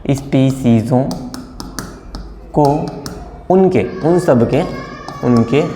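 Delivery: word by word with long pauses; slow dictation. Shown as a demonstration. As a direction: dictate so students can write. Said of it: "आप भी अपना note करते जाइए".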